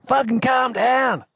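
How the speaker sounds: high, slow, barked order to calm down; mock angry yell. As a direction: snap at the giggling crew like grumpy Al. Al yells at the crew.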